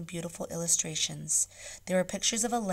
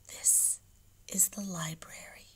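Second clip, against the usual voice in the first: hushed, breathy library whisper; 7 dB quieter than usual. A narrator's reading. Caliph whispering as they enter the silent library. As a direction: whisper it so the readers aren't disturbed. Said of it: "he said in a hushed voice".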